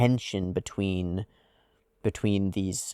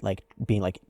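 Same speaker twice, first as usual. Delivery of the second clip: usual speaking voice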